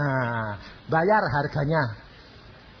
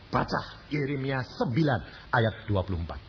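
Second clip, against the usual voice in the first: ordinary talk, nothing marked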